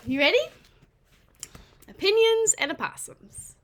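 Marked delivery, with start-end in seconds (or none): 1.9-3.6 title announcement. Acted. Reads the book title aloud.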